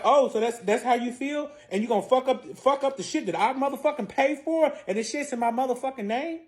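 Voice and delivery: high, affronted questioning; acted outraged challenge; retold indignation. Acted indignant confrontation in quarrel.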